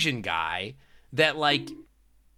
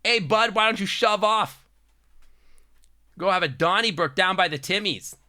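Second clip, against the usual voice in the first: put-on Ontario hockey bro accent; 6 semitones higher than usual. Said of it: "hockey bro accent".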